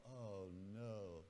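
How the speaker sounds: low, soft acted groan of dismay; quiet dread. Student sees the same girl called beside him, quiet dread. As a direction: think it aloud with a sinking feeling.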